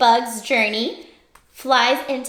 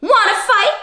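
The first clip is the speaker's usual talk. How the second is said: high, squeaky challenge; grouchy ladybug; 10 semitones higher, 4 dB louder than usual. Acted. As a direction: pick a fight in a tiny voice.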